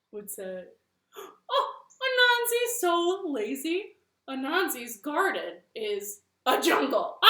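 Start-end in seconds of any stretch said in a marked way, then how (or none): 1.2-7.2 high, loud mocking elephant voice. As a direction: tease the spider loudly from next door. Elephant mocks Anansi.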